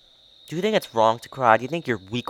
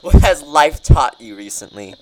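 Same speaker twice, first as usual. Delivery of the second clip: louder, insistent repeat of a question; emphatic repeat; 2 semitones lower, 8 dB louder than usual. Real, not acted. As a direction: repeat it loudly for someone who missed it. Repeats question after "What has what?.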